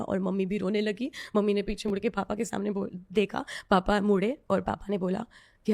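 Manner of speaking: usual speaking voice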